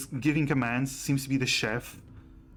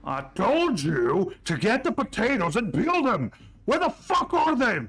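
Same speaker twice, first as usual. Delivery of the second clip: high, loud bellowing about the potatoes; furious dwarf chef; 9 semitones higher, 4 dB louder than usual. Acted. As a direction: tear into the servant like an enraged cook. Chef yelling at servant.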